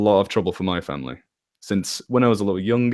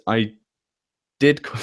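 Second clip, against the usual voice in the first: ordinary talk, nothing marked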